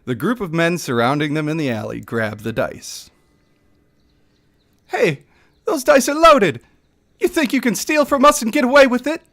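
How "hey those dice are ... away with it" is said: much higher, loud accusing thug; furious villager calling out the cheat; angry local. Acted. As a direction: local spotting loaded dice.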